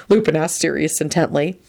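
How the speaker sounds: ordinary delivery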